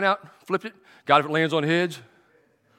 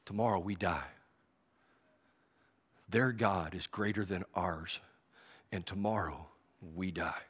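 hushed, lower, slow dread; fearful soldier; 10 semitones lower, 12 dB quieter, slower than usual. Acted. Enemy soldier in camp foreseeing defeat, hushed. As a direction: let the doom slip out softly. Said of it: "one of those guys down there".

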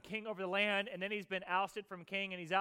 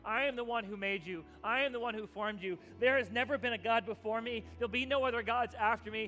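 louder, driving sermon voice of God's promise; preaching at full force; 2 semitones higher, 4 dB louder than usual. Acted. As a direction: proclaim it boldly to the congregation.

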